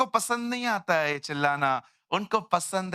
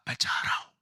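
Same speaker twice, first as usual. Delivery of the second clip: unvoiced whisper; faster than usual. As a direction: whisper it, giving up. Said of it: "मैंने बोला". (फुसफुसाकर कहना)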